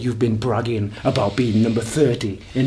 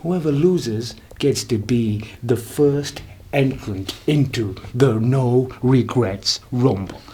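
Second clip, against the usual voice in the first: normal talking voice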